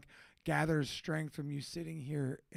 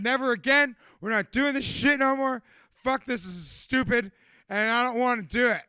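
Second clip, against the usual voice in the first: mock fed-up rant; 5 semitones higher, 8 dB louder than usual. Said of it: "I told Chad".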